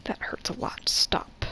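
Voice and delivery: pained whisper. Real, not acted. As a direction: breathe the words through pain. Leg cramp.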